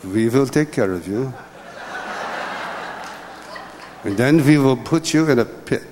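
hammed-up German accent, W turned to V; horrible German accent. Son imitates his father's German doctor, horrible German accent. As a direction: do a bad comic German accent. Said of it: "in my horrible German accent".

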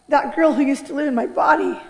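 ordinary talk

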